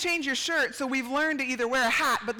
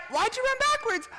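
puzzled parent; 13 semitones higher than usual. Retold or imitated. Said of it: "you're like".

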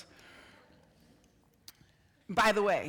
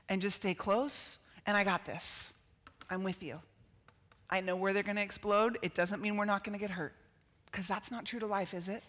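reassuring God; 4 semitones lower, 6 dB quieter than usual. Acted. God speaking tenderly.

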